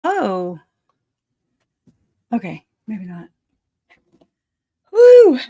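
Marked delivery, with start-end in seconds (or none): 4.9-5.4 high, loud woo after running; breathless woo. Real, not acted.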